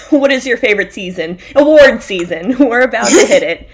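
loud, laughing delight over award season; excited award season. Real, not acted. Co-host gushing to her partner about award season, loud and laughing.

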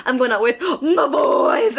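slow imitation with "my boys" heavily drawn out; crazy emphasis on MY BOYS. Co-host mocking how a movie guy announces his plans, crazy emphasis on MY BOYS. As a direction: exaggerate "my boys," mocking the line. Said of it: "The emphasis is that is put on the phrase".

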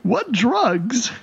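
high, panicky elf voice; frightened elf captive. Acted. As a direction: stammer it out as a terrified prisoner.

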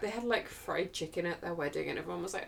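ordinary talk, nothing marked

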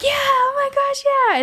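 put-on high, slow squeal of girls cheering; mock kids cheering. Party girls cheering for the movie, a high squealing mock cheer. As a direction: gush like excited party girls.

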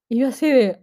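normal talking voice

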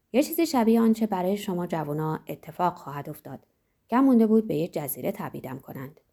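ordinary delivery, nothing marked

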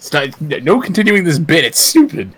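high, heated telling-off; exasperated outburst. Pretend. Host shutting down a stupid bit, mock exasperated.

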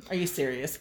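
low, soft flat husband voice; a hint of the unimpressed husband; husband's deadpan. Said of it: "he's like".

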